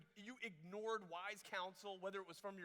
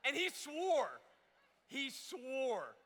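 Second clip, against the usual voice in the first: high, loud, hammed-up protest; mock indignant insistence; 6 semitones higher, 7 dB louder, much slower than usual. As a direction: plead his case with exaggerated conviction. Mimicked lovestruck defense of boyfriend.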